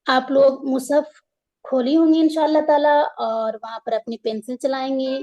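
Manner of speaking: ordinary delivery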